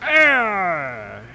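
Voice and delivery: angry growl. Retold or imitated. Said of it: "She goes like".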